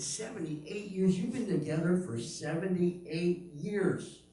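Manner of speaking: high, drawn-out disbelief; incredulous repeat. Retold or imitated. Comedian reacts to couple's long marriage, incredulous.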